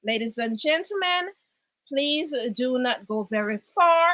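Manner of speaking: normal talking voice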